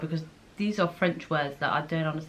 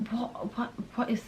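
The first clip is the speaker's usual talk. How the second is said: baffled whisper; 4 semitones higher, 8 dB quieter than usual. Real, not acted. Baffled by the quiz question.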